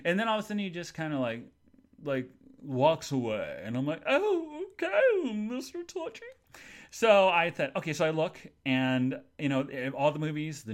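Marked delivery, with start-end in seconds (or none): 4.1-6.2 very high singsong jibe; mocking sarcasm. Library visitor about the man walking off, mocking singsong. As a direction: tease them in a squeaky put-on voice.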